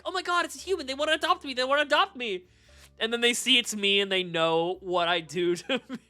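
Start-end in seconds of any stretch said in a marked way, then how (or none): 0.0-2.4 high, eager pleading voice; excited pound dog. Acted. Dog in a pound hoping for adoption, high excited chatter. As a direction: voice a shelter dog thrilled to see a human. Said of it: "like dogs in a pound where they're like".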